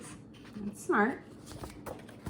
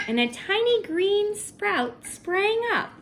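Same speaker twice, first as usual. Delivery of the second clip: delighted reveal; 10 semitones higher, 4 dB louder, slower than usual. A narrator's reading. Reading the sprout appearing.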